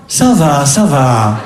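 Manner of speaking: a low, dragged rasp of a weary mother; mother's rasping groan. Retold or imitated. Son phones, mother answers with a low guilt-laden rasp. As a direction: groan it out like a guilt-tripping mum. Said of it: "Elle a un râle".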